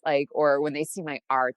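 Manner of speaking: normal talking voice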